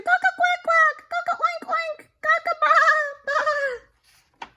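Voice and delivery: high loud string of wrong crows; rapid-fire alarm crows; frantic mixed-up crows. Acted. Rooster crowing to wake the animals. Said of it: "shouted Rooster".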